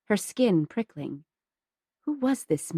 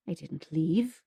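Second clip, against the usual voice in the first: dark and quiet; 3 semitones lower, 5 dB quieter than usual. Acted. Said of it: "his face going dark".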